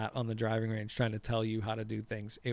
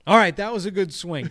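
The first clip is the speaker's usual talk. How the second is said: loud, high mock praise; pitched-up cheerleading for a beginner; coaching boyfriend; 7 semitones higher, 9 dB louder than usual. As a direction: imitate an over-encouraging golf coach. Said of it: "he is teaching her".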